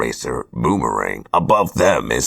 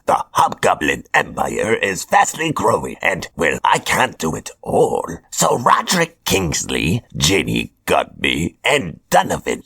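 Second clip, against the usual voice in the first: higher, theatrical villain voice; Hobgoblin voice; 4 semitones higher than usual. Acted. Hobgoblin announces his chosen apprentices, smug villain voice. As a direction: play a smug supervillain handing out orders.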